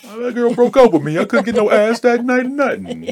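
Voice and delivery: high, whiny complaining dude; pimp suit guy. Retold or imitated. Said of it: "He's like".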